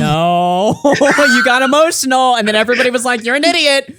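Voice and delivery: laughing mockery. Real, not acted. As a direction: shout the taunt while cracking up. Mocking the man between laughs.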